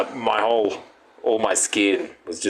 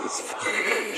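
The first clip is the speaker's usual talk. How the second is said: whispered; 7 dB quieter, slower than usual. Retold or imitated. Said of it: "I was like".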